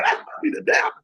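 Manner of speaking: high, squeaky mock doubt; hammed-up nervous second-guessing; mock suspicion. Acting out doubt at God's request.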